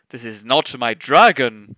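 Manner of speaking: loud angry roar. Acted. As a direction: roar it like rolling thunder. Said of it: "the Emperor roared".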